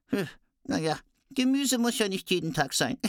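high dwarf voice musing; dwarf aside. Acted. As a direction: shrug it off as a high little dwarf. Dwarf comments on vegetables.

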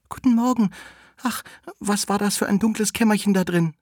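put-on high-pitched little-girl voice; sweet Rotkäppchen. Rotkäppchen climbs out of the wolf's belly, high sweet voice. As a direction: pop out with a high child voice. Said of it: "allerliebste Rotkäppchen heraus und sagte".

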